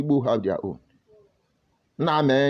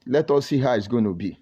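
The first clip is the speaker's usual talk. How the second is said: ordinary talk, nothing marked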